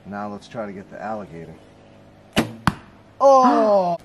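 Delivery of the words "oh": loud, high "oh!"; excited shout. Real, not acted. Archer reacting to his shot, excited shout. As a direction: cry out high and loud at the hit. Arrow hits the target.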